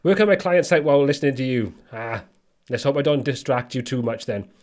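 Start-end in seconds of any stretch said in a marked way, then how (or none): none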